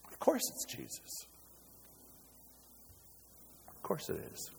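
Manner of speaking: soft, breath-only whisper; whispered wonder, with a long pause; awed whisper. Real, not acted. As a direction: drop to a hushed whisper of certainty. Drops to hush after shouting climax.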